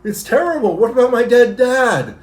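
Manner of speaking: hammed-up sarcastic lament; mocking whiny imitation. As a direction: parody her as overwrought and self-pitying. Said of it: "gets huff puffed out of her mind".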